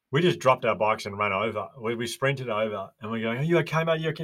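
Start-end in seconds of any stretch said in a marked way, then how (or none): 3.4-4.2 put-on high, urgent calling to a hurt child; urgent high call to the crashed kid; anxious calling out. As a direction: call out, worried, is he alright.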